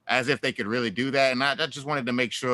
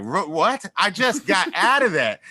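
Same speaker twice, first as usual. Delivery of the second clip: mock-startled, higher pitched with a laugh; retold disbelief; 6 semitones higher, 4 dB louder than usual. Newcomer reacting to his GPS naming Robert E. Lee Drive, mock disbelief. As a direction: replay your double take, half laughing.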